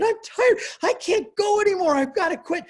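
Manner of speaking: put-on high, complaining small child voice; high-pitched tired kid whine; whiny little kid. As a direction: play a worn-out little boy. Little boy giving up in pool.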